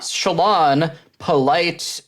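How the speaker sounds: polite nudging. Retold or imitated. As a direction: imitate a polite nudge to back off. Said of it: "polite nudging that maybe you're going".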